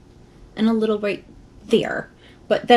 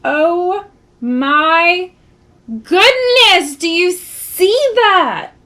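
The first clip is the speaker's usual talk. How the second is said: high, loud, amazed, slow; excited; 7 semitones higher, 5 dB louder, much slower than usual. Real, not acted. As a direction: gush over it. Delight at new highlight.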